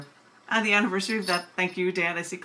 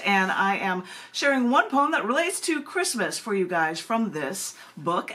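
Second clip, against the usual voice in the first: normal talking voice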